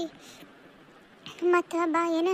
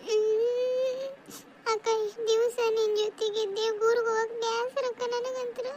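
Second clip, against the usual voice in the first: higher, slow, tear-choked pleading; crying; 6 semitones higher, slower than usual. Acted. Daughter leaving her sister, speaking through tears. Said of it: "ಅಳ್ಬಾರ್ತಿಗೆ".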